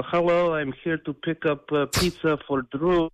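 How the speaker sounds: hammed-up Russian accent ordering pizza. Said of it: "in full accent".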